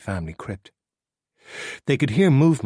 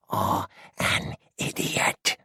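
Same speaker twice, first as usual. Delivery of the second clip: slow rasping whisper; coarse whisper; 3 dB quieter, much slower than usual. A narrator's reading. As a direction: grate it out in a harsh whisper. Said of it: "whisper so coarse it was sliding off sandpaper".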